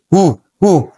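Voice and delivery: high, falling hoot at modest volume; quick high-pitched mock yell; imitated signal shout. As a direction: mimic a short warning holler. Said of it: "sumisigaw siya".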